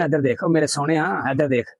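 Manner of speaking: soft sweet-talk to the bull; tender coaxing. Real, not acted. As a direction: coax the animal gently, like a pet.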